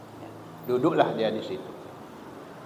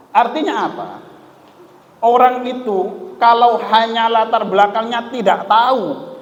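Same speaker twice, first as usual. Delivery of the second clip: much higher and louder, driving it home; preaching at full force; 10 semitones higher, 6 dB louder than usual. Acted. Preacher warning the congregation against arrogance, loudly. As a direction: hammer the moral at the congregation.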